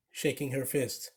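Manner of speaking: plain reading voice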